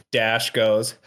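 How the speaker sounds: ordinary talk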